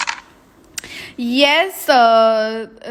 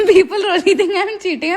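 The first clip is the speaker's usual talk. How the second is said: laughing; 5 semitones higher than usual. Real, not acted. Laughing about cheating accusations.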